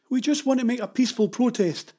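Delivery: high-pitched, insistent objection; protesting woman. Acted. Woman protests to angry father.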